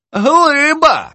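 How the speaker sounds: high, ringing exclamation; mock-grand exclamation. Poetess crowns young editor a giant, mock-grand. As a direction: proclaim it high with mock awe.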